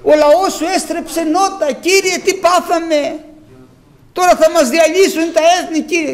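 loud, anguished pleading; crying out in despair. Acted. Joshua pleading with the Lord after defeat, loud anguished cry. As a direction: plead with the Lord as if all is lost. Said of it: "φώναζε".

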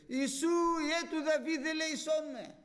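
high, loud desperate cry for mercy; Bartimaeus crying out. Acted. Blind Bartimaeus crying to Jesus for mercy, loud and high. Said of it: "Αυτός πολλό μάλλον έκραζε".

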